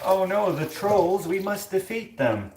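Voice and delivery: high, slow mock-heroic proclamation; hammed-up alarm, rallying against a foe; King Arthur. Announcing the troll threat, hammed-up heroic alarm. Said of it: "King Arthur was like".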